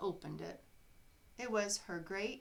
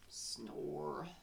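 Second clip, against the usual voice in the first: snore; 4 semitones lower, 3 dB quieter than usual. Acted. Narrator snores in Sleepy Gulch.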